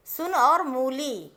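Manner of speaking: सुन with a quick short u, मूली with a slow drawn-out long ऊ; short and long u contrast. Shown as a demonstration. Teacher contrasting सुन and मूली for pupils, slow and deliberate. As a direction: say सुन short and snappy, then stretch the ऊ in मूली. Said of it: "आ रहा है उच्चारण में अंतर ना".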